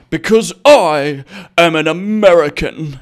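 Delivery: loud, slow heroic boom; buff cartoon hero. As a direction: bellow it like a caped superhero. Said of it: "huge buff cartoon character".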